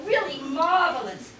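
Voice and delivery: ordinary delivery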